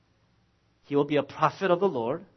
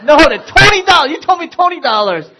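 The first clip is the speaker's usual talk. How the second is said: much higher, louder refusal; insistent kid; 8 semitones higher, 14 dB louder, faster than usual. Retold or imitated. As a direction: refuse the deal like a stubborn kid. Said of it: "He's like".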